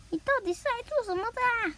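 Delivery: a hint of a high, cross parent voice; scolding parent. Gian's parent scolding Nobita, lightly voiced and high. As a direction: throw the question at him, lightly voiced.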